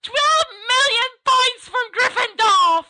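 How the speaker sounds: high, slow shrieking teacher. Acted. Professor shrieking after the fleeing boys, high and loud. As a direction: shriek it down the corridor. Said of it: "roars and laughter and a shriek of".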